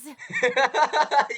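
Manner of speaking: bursting into a loud, high laugh; a real amused cackle at the screen. Real, not acted. Gamer laughs at cutscene.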